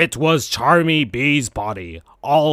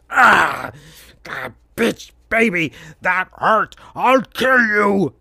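pained cry into a slow higher threat; hammed-up villain scream; 5 semitones higher, slower than usual. A narrator's reading. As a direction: howl from the poked eyes. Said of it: "screamed Vector".

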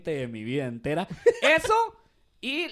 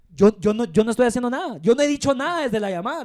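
louder, protesting it wasn't him; defensive protest; 2 semitones higher, 9 dB louder than usual. Retold or imitated. Agent telling supervisors he did nothing, a loud defensive protest. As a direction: plead innocence to the supervisors. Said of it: "y yo me quedaba así como".

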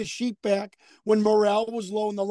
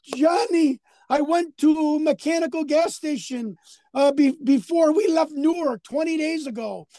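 Iron Sheik impression; 5 semitones higher than usual. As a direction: wail it like a distraught Iron Sheik, shouting Jabroni. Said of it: "And he goes".

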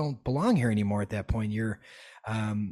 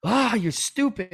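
much higher mild mock yell; retold yell; 8 semitones higher than usual. Retelling arguing with mask wearers, mild mock yell. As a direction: fling a half-hearted mock insult. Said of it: "argue and yell and scream".

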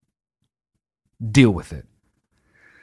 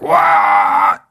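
high, loud drawn-out shout; stretched, shrieking what; mock disbelief; 6 dB louder than usual. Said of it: "We can be like".

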